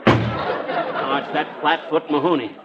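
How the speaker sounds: ordinary delivery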